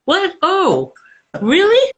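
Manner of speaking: high, rising disbelief; really surprised, pitch jumps way up; amazed what, oh really. Real, not acted. Host surprised at all-Pidgin movie.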